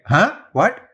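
mock double take at an odd accent; startled confusion. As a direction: react as if a word jumped out at you. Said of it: "and I'll just be like".

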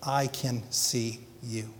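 calm her gently like a loving father; tender reassurance. Retold or imitated. Father comforting his trapped daughter, soft and slow. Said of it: "The dad said".

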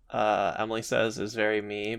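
normal talking voice

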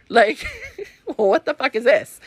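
laughing it out in disbelief, pitch up; cracking up at how absurd it tastes. Real, not acted. Baffled by the soda taste.

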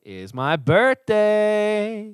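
high, slow and loud, like a grand reveal; drawn-out proclamation; dramatic announcement. Pretend.

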